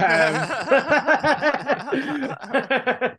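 high loud laugh at own mistake; burst of laughter. Real, not acted. Laughing after mixing up the news. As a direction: crack up laughing.

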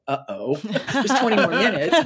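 mock dread, laughing through it. Moviegoer realizing a sad ending is coming, laughing. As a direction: laugh as the twist dawns on you. Viewer checking watch, laughing.

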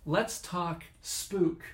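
drawn out slowly; slow spooky drawl. Acted. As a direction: stretch each word out. Host starting spooky topic.

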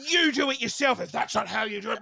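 re-enacted petulant shouting; retold tantrum. Daughter throws a tantrum at mum in the kitchen, shouting. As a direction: throw the job back in a huff. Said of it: "I threw this huge tantrum".

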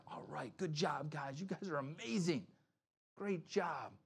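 play-acted gushing praise, kept light; mock congratulation. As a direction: imitate an approving pat on the back. Imagined praise Jesus would not give.

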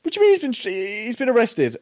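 very high shocked squeak; mock freak-out; panicked disbelief. [name] panicking.

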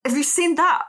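usual speaking voice

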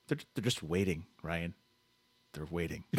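low, hushed and ominous. Acted. [name] spooks Ryan with waiting wolves, low and hushed. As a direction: drop the voice, spooky, about the wolves.

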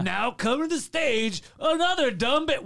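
hammed-up stage announcer, drawn out then rising high; strip club DJ. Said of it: "a strip club D J".

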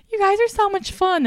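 high, fake-cheery crowd gush; mock comedian gushing. Mocking a bombing comedian to the audience, high fake cheer.

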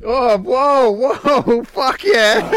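high, loud excited whoops; excited whooping. Real, not acted. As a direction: whoop through the burn. Reacting to the burning chili gummy.

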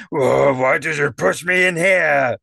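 high, loud indignant wail; drawn-out outraged complaint; angry, disgruntled. Acted. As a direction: shout it like a disgruntled clown. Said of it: "a rather angry, disgruntled voice saying".

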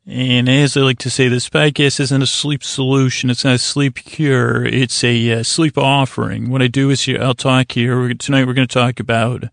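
ordinary talk

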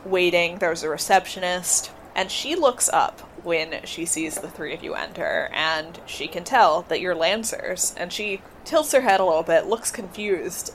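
ordinary delivery

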